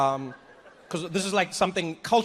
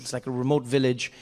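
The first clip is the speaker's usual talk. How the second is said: ordinary talk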